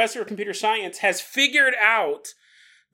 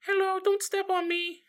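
tiny, high, softer creature voice; little voice; 11 semitones higher, 5 dB quieter than usual. Acted. Mushroom begging not to be stepped on, tiny high voice. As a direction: plead in a small squeaky pitch. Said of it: "we hear this little voice go".

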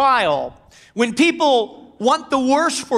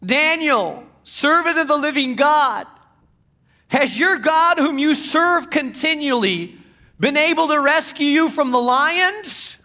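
anguished call; 6 semitones higher than usual. Acted. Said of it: "he called to Daniel in an anguished voice".

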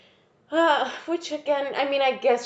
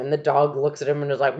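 normal talking voice

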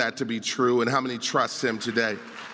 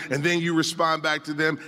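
usual speaking voice

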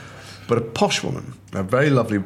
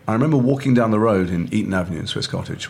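normal talking voice

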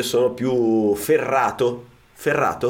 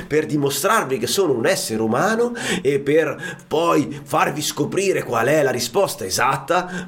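usual speaking voice

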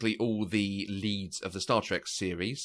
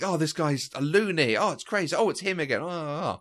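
higher-pitched retold heckling; imitated mocking; 6 semitones higher, 3 dB louder than usual. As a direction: mimic a woman jeering at the screen. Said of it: "like, mocking him and saying".

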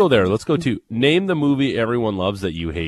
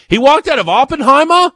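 loud, very high cartoony Massachusetts accent; 11 dB louder than usual. Host mocking someone who walked out, in a cartoony Massachusetts accent. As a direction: shout it incredulously in broad Massachusetts. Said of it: "it's a Massachusetts accent".

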